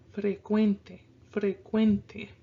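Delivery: "frecuente" run together as one word; connected "frecuente", stressed on cuen; flowy. Shown as a demonstration. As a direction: let fre-cuen-te flow without breaks. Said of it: "we're gonna loop our voice".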